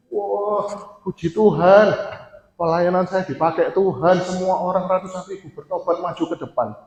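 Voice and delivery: mock-proud high voice, bragging about his ministry; boastful preacher. Preacher boasting to the congregation, mock-proud and high. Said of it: "Normalnya kan kayak gitu tuh".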